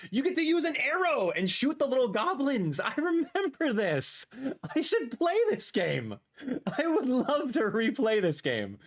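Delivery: high-pitched, delighted enthusiasm; excited gushing. Real, not acted. Streamer reliving a beloved game, excited. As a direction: gush with real excitement, voice riding high.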